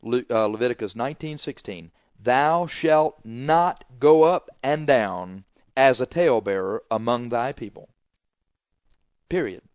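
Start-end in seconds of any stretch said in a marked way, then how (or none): 2.2-7.9 each word drawn out slowly, rising on go; slow scripture reading. Shown as a demonstration. Preacher reading the verse to the congregation, slowly. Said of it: "slow reading of the verse".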